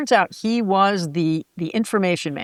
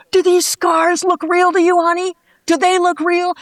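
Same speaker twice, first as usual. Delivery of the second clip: much higher and louder, mock outrage; hammed-up wounded pride, showing scars; indignant wrestler; 11 semitones higher, 6 dB louder than usual. Wrestler showing his scars to the reporter, high loud outrage.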